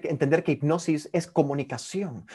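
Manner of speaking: ordinary talk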